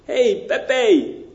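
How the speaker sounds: hammed-up Mexican wanderer sniffing bacon; comic Mexican accent. Joke voicing Mexican wanderer.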